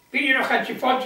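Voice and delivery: normal talking voice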